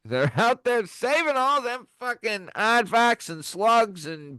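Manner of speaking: rough attempt at Australian; high-pitched, shaky-grasp Australian accent. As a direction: put on an amateur Australian accent. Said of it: "some accent work here".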